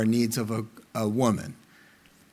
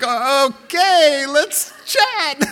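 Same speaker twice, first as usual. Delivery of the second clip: higher, louder, forced eagerness; mock nervous; 7 semitones higher, 6 dB louder, much slower than usual. Husband sitting down to chat, higher forced eagerness. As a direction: force yourself to sound keen. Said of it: "And I'm sitting down like".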